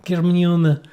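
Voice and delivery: mock Russian, rising on Привет; a playful fake Russian accent to a friend; stupid fake Russian accent. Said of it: "making that stupid accent".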